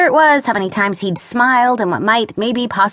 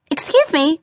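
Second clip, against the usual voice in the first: high, chirpy politeness; put-on perky voice; sprightly; 8 semitones higher than usual. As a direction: pitch it up, brightly sprightly. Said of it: "said in her most sprightly voice".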